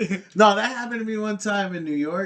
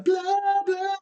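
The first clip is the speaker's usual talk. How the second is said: very high mimicked song part; high-pitched mimicry; 14 semitones higher than usual. Quoting the guy on the game song, high mimicry. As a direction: imitate it far above your usual pitch.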